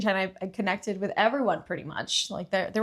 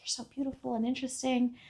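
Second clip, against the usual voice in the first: high, admiring fan voice; imitated gushing; 6 semitones higher, 4 dB quieter than usual. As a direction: gush lightly in a raised pitch. Voices locals gushing at a foreigner.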